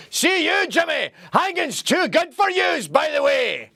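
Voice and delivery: high, rowdy Glaswegian shout, pitch way up; drunken Glasgow yell. Retold or imitated. Brash Scot storming a room, drunken Glaswegian yell. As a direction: bellow it like a drunk Scot bursting in. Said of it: "come screaming into a room and go".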